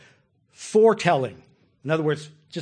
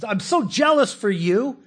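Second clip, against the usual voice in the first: raised, fervent voice of God declaring his love; voicing God's passionate love; 6 semitones higher, 6 dB louder than usual. Preacher voicing God to his people, passionate and fervent. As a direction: pour out a jealous, passionate love, slower at the end. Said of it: "here's his words of comfort".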